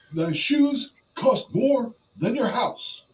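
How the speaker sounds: high, slow bragging cadence; Ric Flair. Acted. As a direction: stretch each boast out, pitched high. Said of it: "it's kinda in the cadence".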